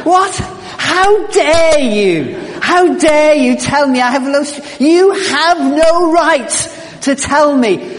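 a hammed-up furious rant at the stress test; mock fury. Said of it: "I was furious".

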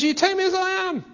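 defiant world. Retold or imitated. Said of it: "And the world says".

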